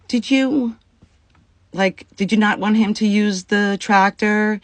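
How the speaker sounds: high, put-on polite voice, feigning innocence; overly sweet question. To the farmer's wife at her door, faux-sweet and high. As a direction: ask it sugary high to hide the annoyance.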